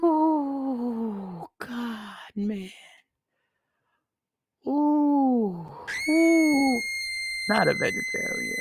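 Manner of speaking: drawn-out pained "ooh" groans, rising to a squeak. Real, not acted. Groaning about his vegetarian wife, pained and high. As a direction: groan like it hurts to admit.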